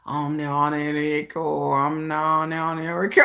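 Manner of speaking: low, slow, hushed and far away; meditative state. Shown as a demonstration. She drifts into her meditative state, low and slow. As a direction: sink into a calm trance, drawn out. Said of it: "put myself in a meditative state".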